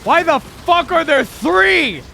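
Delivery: outraged shout. Real, not acted. Outraged at three enemies.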